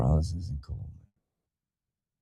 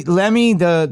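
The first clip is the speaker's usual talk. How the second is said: ordinary delivery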